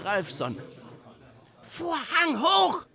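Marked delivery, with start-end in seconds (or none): 1.6-2.9 loud, high, slow director's scream; screamed command. A narrator's reading. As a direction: stretch the order into an urgent yell. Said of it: "schrie der Regisseur". (geschriener Befehl)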